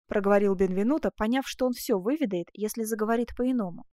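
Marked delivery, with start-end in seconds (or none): none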